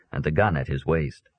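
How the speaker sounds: ordinary delivery, nothing marked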